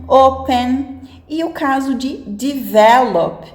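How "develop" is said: "develop" slowly, with the middle syllable, vel, stronger; stress on VEL. Shown as a demonstration. Teacher giving an example verb, "develop" stressed on vel. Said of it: "a sílaba tônica é a parte do meio".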